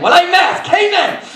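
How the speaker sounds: high, loud happy outburst; thrilled to pass the camera check; excited. Retold or imitated. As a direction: burst out with glee, pitch way up. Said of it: "And I was excited".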